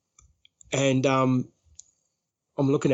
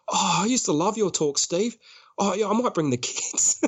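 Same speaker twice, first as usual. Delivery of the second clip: higher-pitched mock enthusiasm; butter him up, pitched up and insincere; put-on fond flattery; lying sweet-talk; 6 semitones higher than usual. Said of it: "so I'm lying".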